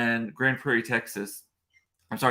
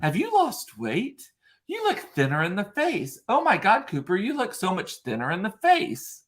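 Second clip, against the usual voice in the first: gushing guests; 7 semitones higher than usual. Retold or imitated. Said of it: "they're like".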